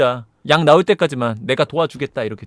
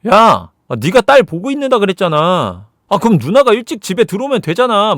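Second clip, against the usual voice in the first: louder, higher mock bickering; acted sibling quarrel; 5 semitones higher, 5 dB louder than usual. Sister and brother bicker over babysitting, raised voices. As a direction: argue back in raised, complaining tones.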